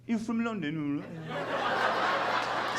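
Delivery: garbled, quiet mock Londoner; mock British accent. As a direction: swallow the words in cartoon London speak. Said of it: "you don't sound British".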